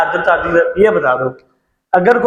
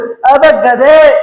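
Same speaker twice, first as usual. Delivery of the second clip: mock scolding; 4 semitones higher, 5 dB louder, much slower than usual. Teacher scolds the wrong answer.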